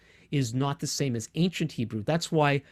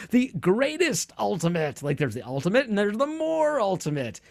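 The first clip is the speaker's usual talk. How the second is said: mock misuse; 6 semitones higher than usual. Teacher mimicking people who misuse penultimate, mock grand. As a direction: mimic people overhyping the word, pitch rising. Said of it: "people in English use penultimate to mean".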